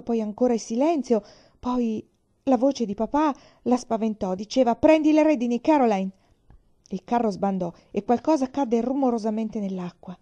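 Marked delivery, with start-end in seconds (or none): 4.8-6.1 loud, higher call over the rushing water; urgent call. A narrator's reading. Father calling to mother to take the reins. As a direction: bark out the order to grab the reins.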